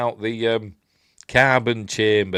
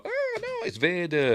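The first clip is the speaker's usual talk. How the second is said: mock alarm; 11 semitones higher than usual. Retold alarm at Vader appearing.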